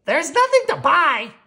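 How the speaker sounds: high, loud exasperated complaint; a fed-up shopper's loud high whine; Millie's complaint. Acted. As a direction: moan that the store had nothing. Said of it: "Millie came home ... and said".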